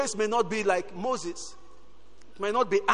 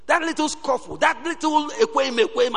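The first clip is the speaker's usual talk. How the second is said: mock petty squabble; 7 semitones higher than usual. Preacher mocking a congregation's petty quarrels. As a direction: sneer at the little scuffles.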